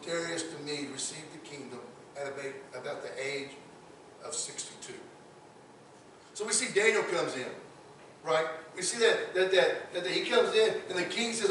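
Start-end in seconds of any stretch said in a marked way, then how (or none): 6.3-11.5 loud, higher preacher's voice; fiery preaching. Acted. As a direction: preach it to the congregation with fire. Preaching builds to full force.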